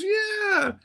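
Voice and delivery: very high imitated "yeah"; put-on high voice, gleeful confirmation; lovely lady caller.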